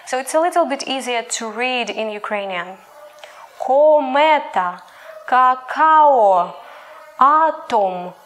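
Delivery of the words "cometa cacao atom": every O in Cometa, cacao, atom said as a full round O; louder word-by-word demo, keep each O pure; unreduced O. Shown as a demonstration. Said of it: "sound O stays where it is".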